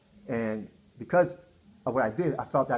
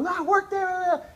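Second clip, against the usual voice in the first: high, loud, drawn-out protest; mimicked frantic refusal; 5 dB louder, much slower than usual. Wife refusing a hospital call, imitated as a high frantic wail.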